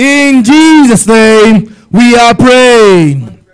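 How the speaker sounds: slow, stretched closing of the prayer; slow solemn prayer ending; drawn-out closing. Acted. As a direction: draw out every word to seal it. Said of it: "drawn-out closing formula".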